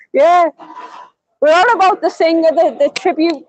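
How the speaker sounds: normal talking voice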